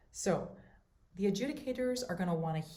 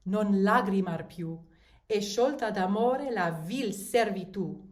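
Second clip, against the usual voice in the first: louder, declaimed Italian reading; dramatic recitation; 5 dB louder than usual. Shown as a demonstration. Teacher reads the aria text aloud, declaimed. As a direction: read it like a poem, projecting. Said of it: "I'm gonna read the text for you".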